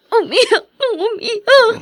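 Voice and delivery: slow, tearful drawn-out words; weeping as he confesses; crying. Acted. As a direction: let the tears slow each word down. Son confiding grief to sister.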